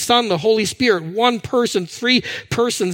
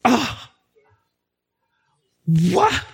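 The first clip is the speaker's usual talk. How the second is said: mock offended "what?"; mock indignant huff. Shown as a demonstration. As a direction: act out an indignant scoff.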